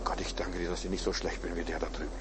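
very soft, low murmured prayer; low confidential aside to God; hushed private prayer. Acted. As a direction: pray it under your breath, barely audible. Acts out a self-righteous prayer.

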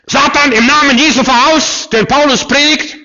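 high, loud mock exorcist cry; hammed-up shrieking exorcist; shouted exorcism command. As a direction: bellow the command at top pitch.

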